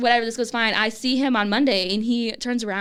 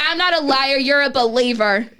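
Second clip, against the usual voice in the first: mock indignant comeback; 3 semitones higher, 4 dB louder than usual. Retold comeback in playful quarrel.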